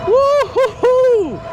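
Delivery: high, drawn-out joyful whoop; excited whoop. Real, not acted. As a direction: let out a long thrilled cheer. Excited cheer during speed test.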